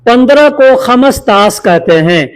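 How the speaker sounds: ordinary talk, nothing marked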